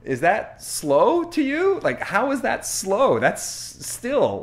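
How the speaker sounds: high, loud mock disbelief; retold incredulous protest, pitched right up. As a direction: challenge them in exasperated amazement. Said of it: "I'm like".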